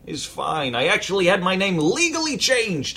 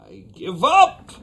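exasperated outburst; 7 dB louder than usual. Acted.

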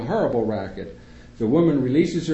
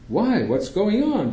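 higher, worried mother's voice; a mild mock panic, pitched up; alarmed mother; 8 semitones higher, 3 dB louder, slower than usual. A narrator's reading. Mother asks the doctor why her son is upset.

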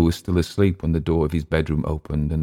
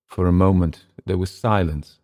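plain reading voice

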